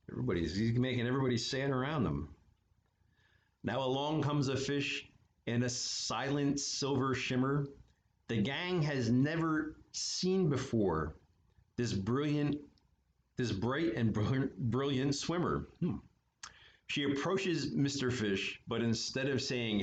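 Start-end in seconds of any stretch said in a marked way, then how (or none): none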